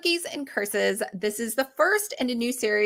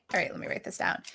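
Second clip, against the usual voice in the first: normal talking voice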